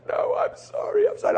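high, breathy imitation of a weeping wife; imitating tearful wife. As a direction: lift the voice high as if crying. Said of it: "she says".

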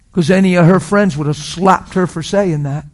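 ordinary talk, nothing marked

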